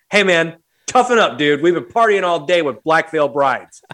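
rally him with a loud, emphatic push; mock tough talk. Telling a pleading buddy to toughen up, mock tough talk.

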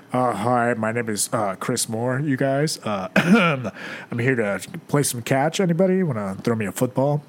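low, stiff jock impression; mock macho introduction. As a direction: imitate a regular guy playing it straight. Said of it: "you got up there and were like".

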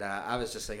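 ordinary delivery

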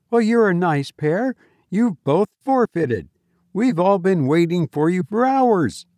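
high-pitched, youthful chiding voice; high voice of an impatient young player; young man's scolding. A narrator's reading. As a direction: scold the pair you have just found. Young Reggie scolds the hidden pair.

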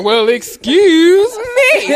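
high, slow, loud "Well, excuse me."; a hammed-up offended lady; mock indignant. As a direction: act affronted, drawing it out high and loud. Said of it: "And I was like".

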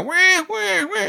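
high squealing rabbit distress cries; rabbit screaming. Shown as a demonstration. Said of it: "simulates a, a rabbit in distress".

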